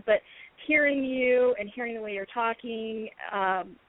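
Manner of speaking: ordinary delivery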